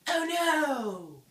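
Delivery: high, loud, drawn-out "oh no"; mock dismay. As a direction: cry out in hammed-up alarm. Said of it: "And you're like".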